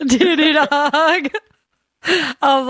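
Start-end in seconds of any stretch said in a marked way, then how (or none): 0.1-1.3 higher, louder teasing repeat; gleefully quoting a favorite line, high and loud; mock taunt. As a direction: ham up the taunt.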